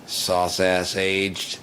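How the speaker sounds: drawn-out angry mutter; loud grumble; slow, loud grumble. Real, not acted. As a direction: spit it out slowly, still mad. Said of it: "Still mad about that".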